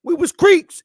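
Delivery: high, loud proud southern elder; imitating a proud old southerner. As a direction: declare it with pride like an old southerner. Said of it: "when you say".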